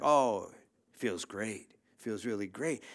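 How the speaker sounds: slow, low, unconvinced mock enthusiasm; half-hearted. Retold half-hearted answer to envious friends.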